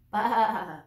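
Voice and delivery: loud bleat calling from far away; distant lamb bleat. Acted. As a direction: call like a lost lamb out in the dark. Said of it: "he heard in the distance".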